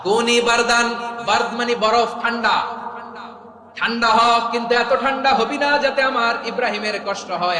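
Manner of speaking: loud, high-pitched sermon delivery; full-force preaching. Real, not acted. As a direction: proclaim it to the whole crowd.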